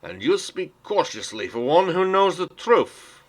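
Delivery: high, loud defiant comeback; defiant retort. Acted. Crate speaker defying the robed councillor, loud. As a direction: throw it back at him in open defiance.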